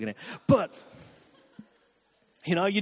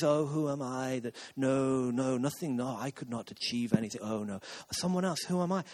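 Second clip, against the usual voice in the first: low, softened, self-effacing imitation; exaggerated meek refusal; mock humility; 7 semitones lower, 5 dB quieter than usual. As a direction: put on false modesty, sinking lower and quieter. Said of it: "you think humility is".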